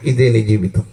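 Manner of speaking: low sorrowful verdict; mournful lament. Acted. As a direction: sink low as if grieving over him. Lament addressed to the dead man.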